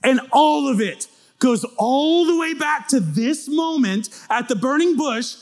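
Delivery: high, shouted sermon delivery; full-force preaching. Acted. Preacher rousing the congregation at full voice. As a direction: preach it to the back row.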